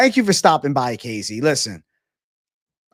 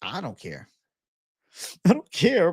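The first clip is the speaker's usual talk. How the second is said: usual speaking voice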